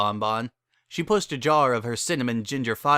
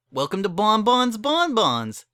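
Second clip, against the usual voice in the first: higher, louder, slow sing-song welcome; bright high candy-shop greeting; cheery shopkeeper Bon Bon; 10 semitones higher, 5 dB louder, slower than usual. A narrator's reading. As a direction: put on your best customer smile.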